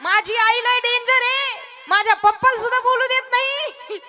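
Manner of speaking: put-on child asking. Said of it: "पण म्हणले".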